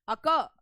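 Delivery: "अक" with a loud, punched क; stress on second syllable. Shown as a demonstration. Teacher showing the second vowel, "अक" stressed on क. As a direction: hit the second syllable of "अक" hard. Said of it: "stress है वो दूसरे syllable पे आ रहा है".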